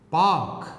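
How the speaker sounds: "पार्क" said paak with long aa, no r; "पार्क" as paak, r silent; no r sound. Shown as a demonstration. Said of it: "यहां पर र का sound इसमें नहीं है". ("पार्क" बिना र के, लंबा आ)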